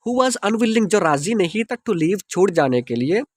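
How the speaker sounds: normal talking voice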